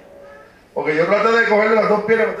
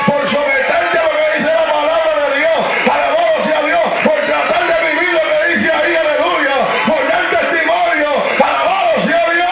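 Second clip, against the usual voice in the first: preaching at full force; 7 semitones higher than usual. Acted. Pastor exhorting the church, preaching at full force. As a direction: preach it hard to the congregation.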